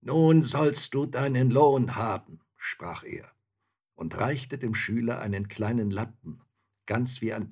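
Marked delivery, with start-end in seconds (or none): 0.0-2.4 loud, somewhat raised pronouncement; giant spirit. A narrator's reading. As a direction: announce it loudly as a giant. Freed giant spirit addresses pupil.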